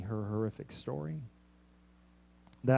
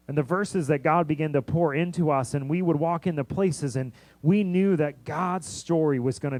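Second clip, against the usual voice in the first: louder, driving sermon voice; preaching at full force; 6 dB louder than usual. Acted. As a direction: push the message out to the congregation.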